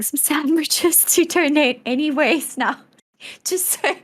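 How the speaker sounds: breaking into laughter, pitch lifting; real giggle through the words; can't keep a straight face at your own joke; laughing while talking. Real, not acted. Amused by own fake charity idea.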